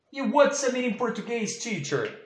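high, fast little pupil voice; childlike pupil question. Acted. Teacher plays a child asking the meaning in a high voice. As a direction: ask eagerly like a small child.

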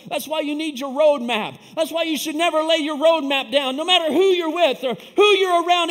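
high, loud pulpit exhortation; full-force preaching. Real, not acted. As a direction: drive every phrase home to the congregation, shouting.

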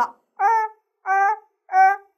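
儿 with the tongue curled back, high and loud; retroflex er. Shown as a demonstration. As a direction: call out 儿 as a clear curled-tongue vowel. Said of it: "发音的时候你的舌头是卷起来的".

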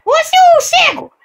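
very high, slow squeaky shout; squeaky mascot yell. Acted. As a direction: screech it like a tiny cartoon octopus. Octopus mascot yells at host.

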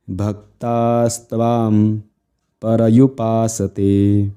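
very slow, drawn-out Sanskrit chant; slow recitation. Shown as a demonstration. Teacher shows students how to recite the verse, very slowly. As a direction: recite it unhurried, holding the long vowels. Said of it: "एकदम हळू, कुठल्याही प्रकारे घाई करायची नाही".

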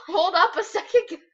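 loud, high, laughing; flustered and giggling. Real, not acted.

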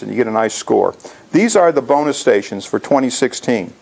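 ordinary talk, nothing marked

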